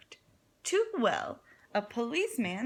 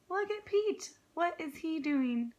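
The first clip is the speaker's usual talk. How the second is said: surprised gasp; 5 semitones higher than usual. Real, not acted. Reader surprised by picture.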